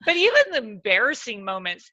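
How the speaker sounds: ordinary talk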